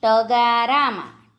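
ટગારામાં drawn out by syllables; slow word demonstration. Shown as a demonstration. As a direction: say ટગારામાં slowly for spelling. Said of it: "જોડણી પર ધ્યાન આપજો".

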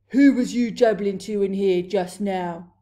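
loud giant's boom. A narrator's reading. Blood Bottler questioning the BFG, booming voice. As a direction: boom it out like thunder. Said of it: "he boomed".

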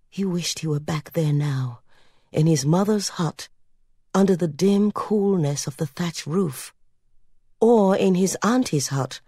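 plain reading voice

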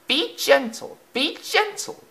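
very high squeaky plea; crying coaches. A narrator's reading. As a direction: plead in a tiny high voice. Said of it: "cried the coaches".

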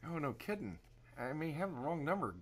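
ordinary talk, nothing marked

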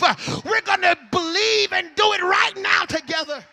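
loud revival preacher rallying the crowd; fired-up church exhortation; full-force preaching. Real, not acted. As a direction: drive each phrase hard, punched with gasped "uh".

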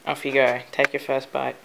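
ordinary talk

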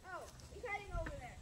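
ordinary talk

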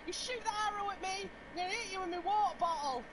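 high, building play-fight threats; mock battle cry. Threatening Spud with a water bottle, high mock-battle shouts. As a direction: pitch it high, ending in a loud burst.

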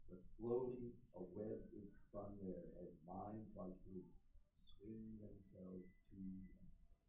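recital voice for the introverts in the room; poem recital. Acted. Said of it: "I'm gonna say this, this poem".

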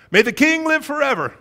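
high, loud, fawning flattery; fawning courtier. Acted. Courtiers flattering the king before gossiping, fawning. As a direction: lay the flattery on thick.

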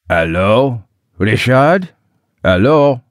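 put-on French-accented male caller. A narrator's reading. French caller asking for Richard on the phone, French accent. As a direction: answer with a thick-voiced French accent, questioning. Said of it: "A deep male voice in a French dialect".

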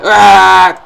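long, high, loud yell; loud scream. Real, not acted. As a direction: scream as you fling yourself in. Scream during game attempt.

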